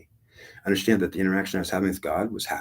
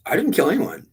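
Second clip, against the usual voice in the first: much higher, louder denial; acted indignant protest; 11 semitones higher, 5 dB louder than usual. Young gunner answering God's accusation, high-pitched mock protest. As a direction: protest innocence in a raised pitch.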